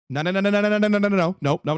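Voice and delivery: imitating an urgent interruption; retold urgent refusal. Retold wife stopping a fight.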